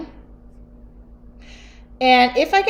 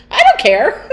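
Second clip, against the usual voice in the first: imitated dad, loud and high, laughing; 7 semitones higher, 7 dB louder than usual. Dad brushing off his daughter in a shop, mock-loud and laughing. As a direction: belt out a comic imitation of a loud father. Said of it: "my dad talks so loud".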